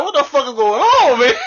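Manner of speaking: high, laughing retold panic; laughing disbelief. Retelling his panic at the store, laughing. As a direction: laugh through the shocked question.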